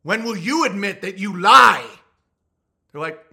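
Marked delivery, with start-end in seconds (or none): 0.0-1.9 high, loud accusation; mock outraged lord. Answering the clerk, a loud outraged lordly accusation. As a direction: hurl it like a wronged noble. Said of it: "the admission process".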